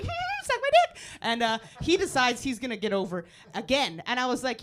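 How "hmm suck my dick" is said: very high, slow gloating squeal; mock taunt. Comic taunting driver from left lane, squeaky gloat. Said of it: "I'm left lane like".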